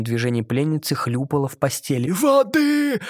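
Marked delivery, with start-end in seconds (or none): 2.1-3.0 high, whining "Воды!"; capricious cry. A narrator's reading. Mermaid capriciously crying for water. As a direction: demand it like a spoilt child.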